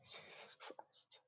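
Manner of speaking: breathed out under the breath, stunned; slow hushed whisper of shock. Real, not acted. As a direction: barely voice it, in disbelief. Stunned reaction to crude joke.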